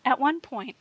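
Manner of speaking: plain reading voice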